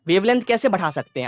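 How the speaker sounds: normal talking voice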